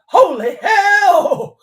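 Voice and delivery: a high, loud, drawn-out cry of shock; startled gazelle. Retold or imitated. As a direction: play a gazelle that just escaped the lion, stretching it out. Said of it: "they stand up and they're like".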